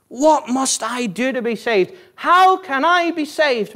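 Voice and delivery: crying out. Acted. Preacher acts the Philippian jailer begging for salvation, crying out. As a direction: cry it out in acted desperation, stretching the last question. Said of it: "but he cried out".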